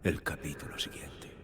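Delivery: low, half-whispered cryptic incantation; ominous visionary voice. Acted. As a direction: intone it darkly, like a voice from a vision. Voz oscura del escritor en visiones. (voz grave y susurrante de visión siniestra)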